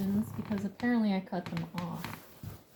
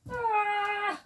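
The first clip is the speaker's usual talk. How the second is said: startled shriek; 4 dB louder than usual. Real, not acted. Startled on finding the mess.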